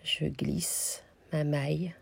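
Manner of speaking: slow, soft step-by-step telling; slowed down so they can follow; slow-motion demo. Shown as a demonstration. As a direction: draw each word out as you show the move. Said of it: "j'ai fait un ralenti pour que ce soit plus visuel".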